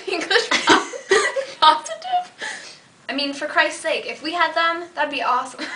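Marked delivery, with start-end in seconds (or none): 0.1-2.3 slow words squeezed through laughter; can't stop giggling; laughing. Real, not acted. Cracking up at the word.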